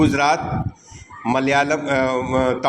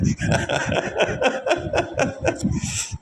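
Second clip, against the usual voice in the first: laugh; 13 semitones higher, 4 dB quieter than usual. Real, not acted. Teacher laughs after remark.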